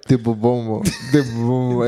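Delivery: "Tibubomo" for tiburón, r and n swallowed; mispronounced tiburón. Retold or imitated. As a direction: say "tibubomo", mangling the word tiburón. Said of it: "she couldn't say it".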